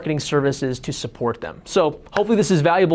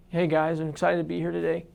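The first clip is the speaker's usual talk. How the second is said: bad acting; 8 dB quieter than usual. Shown as a demonstration. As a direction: say it flat and lifeless, no energy. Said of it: "deliver it like this".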